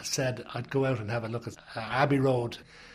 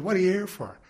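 higher, louder gruff question; 7 semitones higher, 6 dB louder, faster than usual. Retold or imitated. Beatle questioning a visitor at the studio, gruff. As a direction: imitate a gruff, challenging question. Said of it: "kind of gruff".

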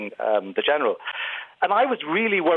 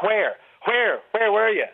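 put-on Dublin accent; 5 semitones higher, slower than usual. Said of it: "Dublin people tend to go like".

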